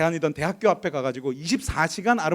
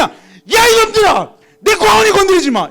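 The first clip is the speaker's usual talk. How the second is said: high, full-voiced yell at bullies; loud, furious acted warning; protective angry shout; 12 semitones higher, 10 dB louder, slower than usual. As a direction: shout them off your child. Father yelling to protect child.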